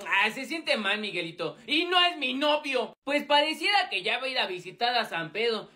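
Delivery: ordinary talk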